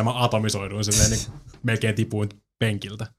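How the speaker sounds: ordinary talk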